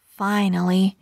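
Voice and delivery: usual speaking voice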